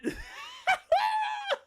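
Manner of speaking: high, squealing laughter; real wheeze-high cackle; helpless laugh. Real, not acted. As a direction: dissolve into a long high laugh. Still laughing.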